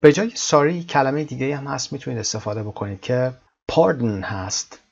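normal talking voice

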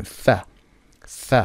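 ث with the tongue between the teeth; sound th. Shown as a demonstration.

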